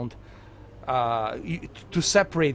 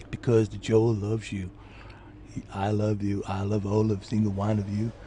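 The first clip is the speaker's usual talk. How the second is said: Forrest Gump voice; 6 semitones lower than usual. Retold or imitated. Telling the viewers he loves them, Gump-style. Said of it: "I sound like a Forrest Gump".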